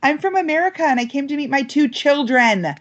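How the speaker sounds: high-pitched, over-eager self-introduction; jacked up. Retold or imitated. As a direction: chirp it like an overexcited new arrival. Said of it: "I was a little jacked up".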